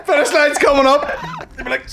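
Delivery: high barked drill sergeant orders. Retold or imitated. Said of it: "Like a drill sergeant".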